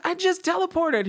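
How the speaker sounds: very high, squealed news to parents; excited announcement. Retold or imitated. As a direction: announce it in a high, giddy pitch. Repeats the news to brother.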